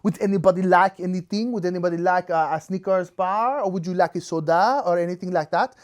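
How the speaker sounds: casual offer in mock French-accented English; hammed-up French accent. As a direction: put on a French lady's accent. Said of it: "this is my French accent".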